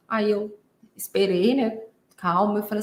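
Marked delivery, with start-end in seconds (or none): none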